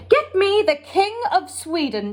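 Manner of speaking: Queen demanding the King of Sweden; queenly order. A narrator's reading. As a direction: speak like a queen giving orders. Queen voiced on the phone.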